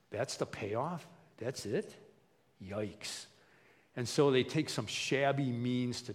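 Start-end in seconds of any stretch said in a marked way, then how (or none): 0.1-3.3 soft, deflated disbelief; mock letdown after a big promise; underwhelmed dismay. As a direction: let it sink in, quieter on the final word. Acted Adam and Eve's letdown.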